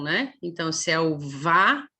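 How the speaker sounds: usual speaking voice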